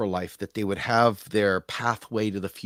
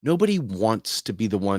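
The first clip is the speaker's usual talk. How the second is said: ordinary delivery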